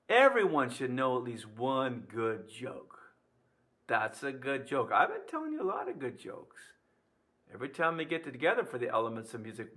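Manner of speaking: ordinary delivery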